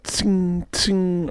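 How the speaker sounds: high, ringing "tsing" pings; light high-pitched ricochet noises; imitated BB pings. Storyteller hears BBs flying behind the office, imitated pings. Imitates BB shots whizzing past.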